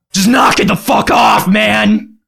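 slow, loud put-on shout. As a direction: yell it at him slowly. Opening skit, shouted line.

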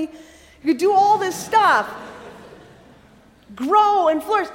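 high, bubbling list of invitations; exuberant. Acted. God telling Adam and Eve to enjoy the garden, exuberant. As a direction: urge them on with generous delight.

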